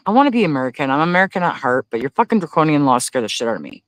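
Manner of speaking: ordinary talk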